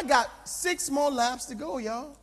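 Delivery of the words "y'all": usual speaking voice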